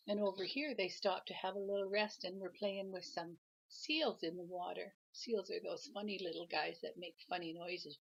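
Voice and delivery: usual speaking voice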